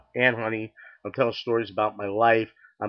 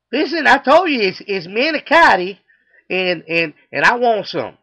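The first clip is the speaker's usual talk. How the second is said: high, loud Texan outburst; insistent southern accent; 9 semitones higher, 9 dB louder than usual. Acted. Customer demands manicotti from the clerk, shouted southern accent. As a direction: holler stubbornly in a Southern accent. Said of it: "he's got a southern accent".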